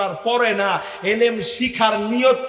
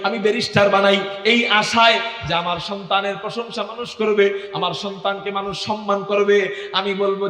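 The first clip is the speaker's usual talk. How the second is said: normal talking voice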